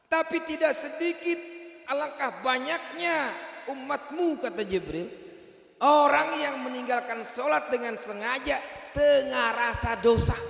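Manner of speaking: loud, high shouted preaching; preacher warning the congregation loudly; fiery preaching. Real, not acted. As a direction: hammer the point home. Preaching at full force.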